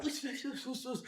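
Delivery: soft rapid whooshes; hushed mock-fight sound effects; imitated swiping noises. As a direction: make quiet swishes for a fighting cub. Imitates baby lion fighting.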